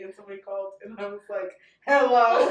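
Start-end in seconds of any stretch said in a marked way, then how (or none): 1.9-2.5 loud, nervous phone greeting; anxious phone hello. Retold or imitated. Answering the business phone for the first time, mock anxious. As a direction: blurt an overloud, anxious hello. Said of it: "I had so much anxiety".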